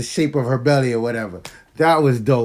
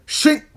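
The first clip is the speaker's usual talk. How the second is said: high, sharp blade sound effect; sword swish; 12 semitones higher, 3 dB louder than usual. Retold or imitated. Fan retelling Thor's axe strike, a high sharp shink. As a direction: whip a quick shink like a blade slicing. Sword chops off Thanos's arm.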